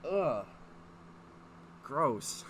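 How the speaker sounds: ordinary talk, nothing marked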